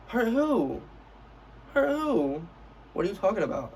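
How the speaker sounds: incredulous comeback. Retold or imitated. Student answering "I don't wanna hurt you", incredulous. Acted scornful comeback to opponent.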